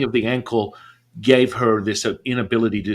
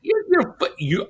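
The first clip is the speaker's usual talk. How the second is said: high, halting stammer; speechless. Retold or imitated. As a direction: act out being too stunned to finish. Speechless disbelief on the phone.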